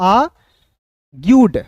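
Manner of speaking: "आर्ग्यूड" drawn out in two slow parts, "aa... gyood"; teacher slowly sounding out "आर्ग्यूड" without the r; syllable-by-syllable demo. Shown as a demonstration. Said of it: "तो आर्ग्यूड पढ़ेंगे".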